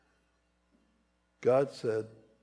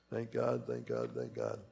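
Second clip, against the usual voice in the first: softer, hushed gratitude; quiet thanks; 14 semitones lower, 7 dB quieter than usual. Real, not acted. Preacher quietly thanking God, hushed.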